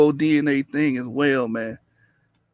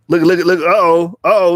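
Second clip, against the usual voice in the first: louder, sudden alert at a new arrival; excited alert; 6 dB louder than usual. Real, not acted. Streamer spots a viewer in chat, excited. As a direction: perk up, someone just showed up.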